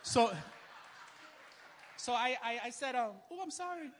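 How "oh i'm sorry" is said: a touch of meek high apology; mock timid sorry; wimpy apology. Preacher mocking his own meek apology to the lady. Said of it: "got all wimpy".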